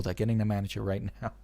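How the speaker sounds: ordinary delivery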